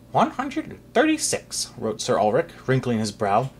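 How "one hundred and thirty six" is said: high, dry counting clerk; fussy clerk voice. Acted. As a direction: count it out primly. Sir Ulrich counting hats.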